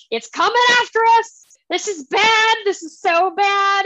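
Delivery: high, loud, fast panicked cry. Retold or imitated. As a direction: yelp it out while running for your life. Fleeing a roaring creature.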